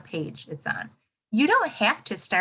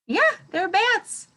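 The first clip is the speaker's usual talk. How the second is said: excited reveal; 11 semitones higher, 5 dB louder, slower than usual. Acted. Teacher answers own question excited.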